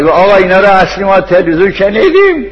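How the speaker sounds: imitating a heckler's high-pitched protest; retold shout. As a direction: call out the objection, pitched well up. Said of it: "پشت داز زد".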